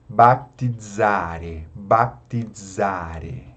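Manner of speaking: "baptizare" slowly as "bap-ti-dza-re". Shown as a demonstration. Teacher modelling the example, "baptizare" with DZ. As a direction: draw out "baptizare" with DZ for Z. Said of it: "tem o som de D Z".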